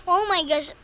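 normal talking voice

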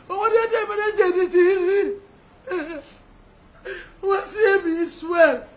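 tearful, voice breaking with sobs; words pushed out through real tears; crying. Real, not acted. Grieving sister recalls her sibling's suffering, crying.

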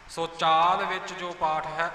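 normal talking voice

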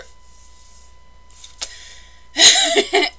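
sudden loud burst of laughter; short, high rueful laugh. Real, not acted. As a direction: laugh it off loudly. Rueful about recovering lost videos.

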